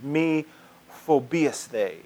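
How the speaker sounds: "me phobias they" said slowly, syllable by syllable, like Greek; careful Greek. Shown as a demonstration. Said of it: "let's see if I can say this right".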